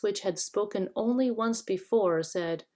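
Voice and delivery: plain reading voice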